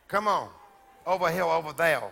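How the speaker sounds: put-on low, soft take on a small child's talk; imitating little boy's speech. Preacher showing how the five-year-old talked, lightly imitated. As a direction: lightly mimic a five-year-old's way of talking. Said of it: "back then he talked like this".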